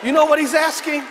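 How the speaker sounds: high, loud, indignant sermon delivery; full-force preaching. Real, not acted. Preacher voicing David's challenge to the army, full-force preaching. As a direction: thunder it out to the congregation.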